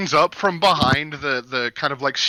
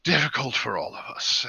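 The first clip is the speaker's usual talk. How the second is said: weary understanding; 5 semitones lower, 7 dB quieter than usual. Acted. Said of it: "and he's like".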